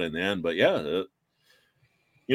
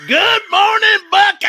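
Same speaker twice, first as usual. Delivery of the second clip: shouted, pitched way up, perky TV host greeting; mock morning news anchor; 12 dB louder than usual. Friend imagining Emily as a TV anchor, shouted perky greeting. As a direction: belt it like an overexcited morning anchor. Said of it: "there's Emily".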